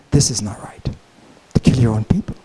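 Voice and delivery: soft voiceless whisper of conscience; inner voice whisper. Retold or imitated. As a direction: whisper it like a conscience speaking inside. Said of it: "some voice in him said".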